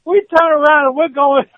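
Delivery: high, loud laughing disbelief. Real, not acted. Player laughing while retelling the crash. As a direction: laugh through the telling.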